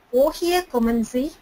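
ordinary delivery

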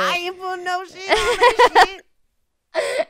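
high, loud country twang; country accent. Pretend. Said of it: "putting a country accent on it".

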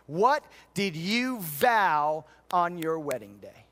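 loud, slow, emphatic pulpit delivery; full-force preaching. Acted. Pastor pressing the vow point on the congregation, booming preaching. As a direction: drive each word home to the congregation.

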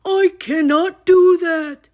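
high, loud refusal; shout. A narrator's reading. Toad refusing Frog's plan, shouting. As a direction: shout it high-pitched in protest. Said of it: "shouted Toad".

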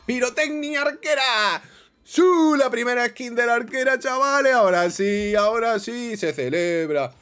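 very high, loud victory yell; euphoric shouting. Real, not acted. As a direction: scream with joy at the win, easing slightly at the end. Celebra a gritos la skin. (gritos de euforia, agudos y fuertes)

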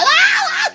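high shriek, half laughing; mock scream. (grito agudo fingido entre risas)

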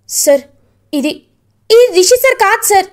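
high, loud burst of relief; relieved surprise. Acted. Vasudhara seeing the body is not Rishi, loud relieved cry. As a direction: blurt it out the moment you see the face.

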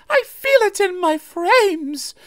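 very high, slow shriek; frightened coach shrieking on a bend. A narrator's reading. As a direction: shriek it out round the curve. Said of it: "she shrieked".

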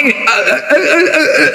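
high, halting eh sounds like a man who has lost his speech; stammering mock mute. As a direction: mimic a man who can't get words out. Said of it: "ngomongnya kan nggak bisa ngomong".

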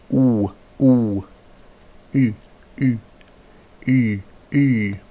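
each vowel short, then held longer. Shown as a demonstration. Teacher demonstrating Old English vowels short and long. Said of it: "I'm gonna say each vowel short and then long".

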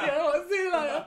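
high, loud laughing goodbye; mock casual goodbye. Casual guests mocked, saying bye high and loud.

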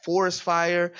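ordinary talk, nothing marked

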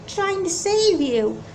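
normal talking voice

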